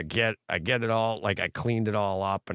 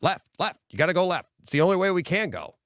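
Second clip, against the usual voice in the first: retold son navigating; 8 semitones higher, faster than usual. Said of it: "And he goes".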